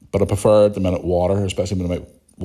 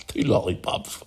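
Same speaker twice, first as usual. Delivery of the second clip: real laugh then breathy repeat; 4 dB quieter, slower than usual. Real, not acted. Podcaster laughing at a listener's joke, laughing. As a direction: laugh at the phrase.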